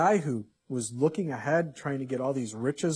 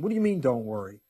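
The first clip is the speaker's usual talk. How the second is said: mildly incredulous objection, near normal pitch; doubting listener; 2 semitones lower, 4 dB louder, faster than usual. Acted. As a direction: question it like a doubtful churchgoer. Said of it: "you may be sitting there going".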